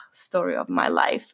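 very low, dropped-voice sigh of resignation; low muttered tagline; resigned catchphrase. Real, not acted. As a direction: drop it right down, wry. Wry closing catchphrase, said twice.

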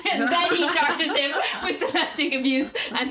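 high, loud, cracking up; laughing while talking. Real, not acted. Telling co-host about the absurd arrest, laughing. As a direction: push the words out through laughter.

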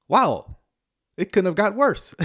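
amazed disbelief. Retold or imitated. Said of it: "Every time I'm like".

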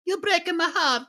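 high falsetto wail; mock heartbreak. Guest teasing the host in a high mock wail. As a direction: squeal it like a wounded diva. Mock hurt at series name.

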